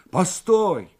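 loud, high protest; shouting. A narrator's reading. Morev stops the driver over the posted letter, shouting. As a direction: cry out to stop him driving off. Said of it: "крикнул Морев".

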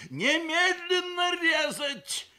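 high, drawn-out urgent yell; shouting. A narrator's reading. Surgeon uncle demanding an operation at once, shouting. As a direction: scream the order, stretching it. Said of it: "закричал дядя".

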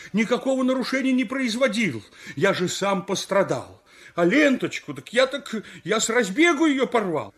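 high-pitched indignant pleading; aggrieved, insistent appeal; protesting innocence. Acted. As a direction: plead your case to the crowd. Runner pleads with his captors.

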